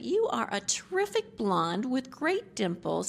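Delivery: a playful self-cheerleading voice; mock pep talk. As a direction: boost herself up in the mirror. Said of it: "it's like".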